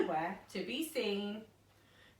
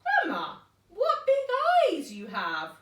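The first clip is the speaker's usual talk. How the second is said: put-on high, slow, amazed girl voice; wide-eyed girl; 10 semitones higher, 6 dB louder than usual. Little girl marvelling at Grandma's eyes, high and slow.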